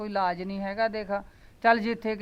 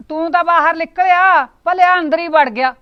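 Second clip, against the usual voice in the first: loud, high scolding yell; angry shout; 7 semitones higher, 14 dB louder than usual. Acted. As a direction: bellow at him to get out of the way. Yelling at the man at the door.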